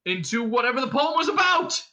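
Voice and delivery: high, loud triumphant finish; yell. A narrator's reading. Ford leaps in with the coup de grace, yelling. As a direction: yell it out as the winning blow. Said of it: "he yelled".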